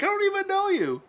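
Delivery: very high put-on squeaky outburst; upset teen girl. Daughter snapping at her new relatives, very high mock outburst. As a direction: fling it at the family like a hurt teenager. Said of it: "she yells at all the family members".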